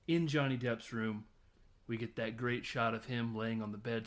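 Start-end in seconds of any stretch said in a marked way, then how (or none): none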